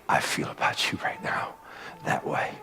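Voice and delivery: soft hushed tender reassurance; God speaking tenderly to his son, soft. Retold or imitated. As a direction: breathe it gently like a father. God's tender reply.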